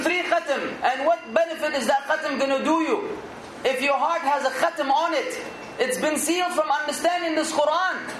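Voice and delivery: normal talking voice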